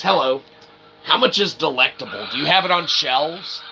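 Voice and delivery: ordinary delivery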